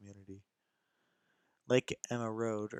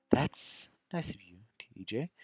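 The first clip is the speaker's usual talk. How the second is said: whisper; 4 dB louder, slower than usual. Acted. Jenna answering TJ's offer.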